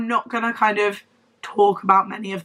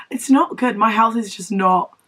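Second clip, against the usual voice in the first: ordinary talk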